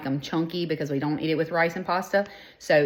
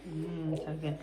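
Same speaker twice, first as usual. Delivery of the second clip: tender; 10 dB quieter than usual. Real, not acted. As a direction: coo it gently. Tender to child, soft.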